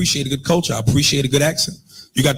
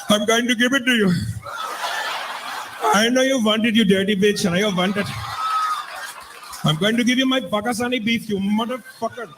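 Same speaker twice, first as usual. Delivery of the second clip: higher-pitched mock Indian accent; East Indian accent; 6 semitones higher than usual. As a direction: put on a broad comic Indian accent. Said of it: "is that East Indian accent".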